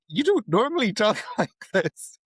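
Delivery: high, swooping mock disbelief; imitated puzzlement. Colleagues questioning the newcomer's accent, high mock puzzlement. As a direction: mimic baffled colleagues, pitched well up.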